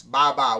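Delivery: loud babbled ba ba; baby babble. Shown as a demonstration. Teacher shows the class barbaric baby-talk sounds, loud babble. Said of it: "It's like a baby talks".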